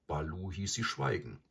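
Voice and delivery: ordinary delivery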